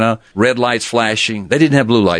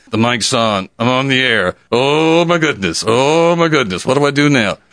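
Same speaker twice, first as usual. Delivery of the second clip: higher, rising panic on live mic; panicked; 6 semitones higher than usual. Retold or imitated. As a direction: caught on air and scrambling.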